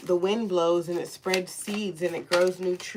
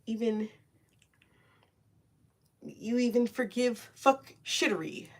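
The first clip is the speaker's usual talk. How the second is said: ordinary delivery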